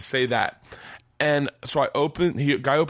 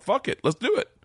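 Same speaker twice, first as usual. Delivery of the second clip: much higher, gung-ho; eager yes; 11 semitones higher than usual. Retold or imitated. Young Bert agreeing to the vodka run, higher and eager. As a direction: jump at the dare. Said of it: "And I'm like".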